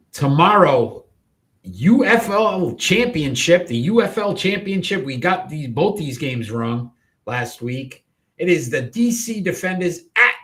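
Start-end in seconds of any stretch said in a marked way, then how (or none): 0.1-5.0 higher, louder championship announcement; host hyping the title game; announcer voice. Acted. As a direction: sell the big game like a broadcaster.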